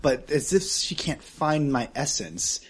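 ordinary talk

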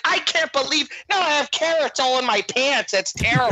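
high, upset Midwestern lady; hammed-up Midwest accent. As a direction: parody a horrified Midwest complaint. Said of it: "her accent was what made it the funniest".

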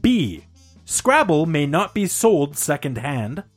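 ordinary talk

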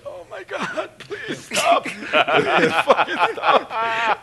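very high, strained whimper building to a shout; hammed-up wailing plea, breaking into laughter; pained pleading. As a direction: beg through acted agony. Hurt, begging friend to stop.

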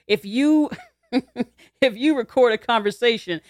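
laughing through the words; can't hold back a laugh at the absurdity. Real, not acted. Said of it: "the reason why I'm laughing".

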